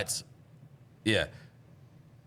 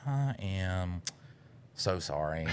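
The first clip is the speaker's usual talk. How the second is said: sheepish apology; 7 dB quieter, much slower than usual. Retold or imitated. Retold apology after horrible message.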